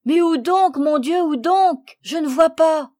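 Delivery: high, loud, frantic cry; frightened, distressed. A narrator's reading. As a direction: search desperately, crying out in dismay. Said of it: "Et Jean, effrayé, désolé".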